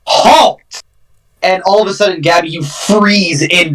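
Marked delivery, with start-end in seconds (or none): 0.0-0.6 mildly bellowed order, pitched up; bellowing command. Acted. Elven lady stopping escaping Gabby with a bellowing command. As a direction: call it out high, as a commanding halt. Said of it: "almost in a bellowing, echoed voice".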